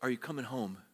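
soft, tender invitation home; hushed fatherly call home; loving father's plea. Retold or imitated. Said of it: "a loving father might ask a son".

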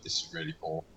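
muttered inner thought. Retold or imitated. Telling friends about watching bad sequels, soft muttered aside. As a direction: quietly admit a guilty habit.

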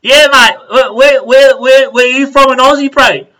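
loud, high shout from behind; excited stranger hollering down the camel train; imitated calling out.

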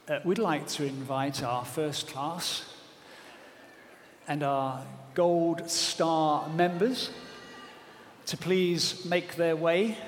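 low, soft airport announcer; gate announcement. Retold or imitated. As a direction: read it like a gate call. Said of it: "they've made this announcement".

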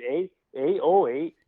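mock Canadian vowels on eight; Canadian accent mimic. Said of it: "exactly how they sounded".

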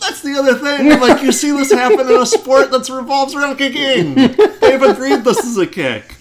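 loud, high exasperated rant. Real, not acted. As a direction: pitch it up in disbelief. Exasperated rant about the kick.

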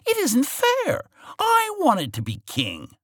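very high, slow whiny tantrum; whining villain. Acted. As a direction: wail it like a spoiled villain. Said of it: "he was shouting at her".

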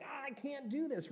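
high exasperated cry of giving up; frustrated outburst. Retold or imitated. A congregant losing control, mock frustrated cry. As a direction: throw up your hands, voice jumping high.

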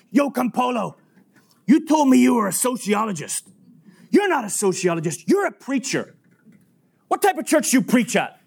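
high-pitched, accusing outburst; confrontational challenge. Retold or imitated. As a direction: imitate a man calling someone out. Harry confronts preacher.

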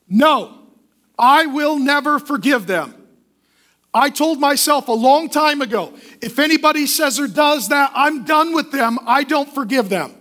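hammed-up shout of a grudge-holder; defiant refusal. A stubborn believer shouting a refusal at God, defiant.

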